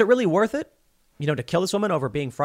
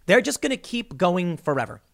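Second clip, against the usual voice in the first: mock New Zealand accent; 7 semitones higher than usual. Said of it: "She was like".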